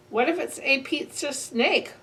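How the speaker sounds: ordinary delivery, nothing marked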